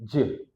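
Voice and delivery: J said as "juh", not the letter name; voiced J into a short schwa; j sound. Shown as a demonstration.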